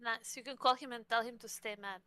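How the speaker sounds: ordinary talk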